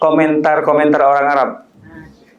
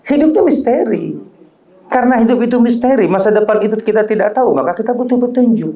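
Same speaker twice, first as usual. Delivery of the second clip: raised, urgent sermon voice; emphatic preaching; 8 semitones higher, faster than usual. Acted. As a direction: drive the point home to the congregation. (khotbah yang berapi-api)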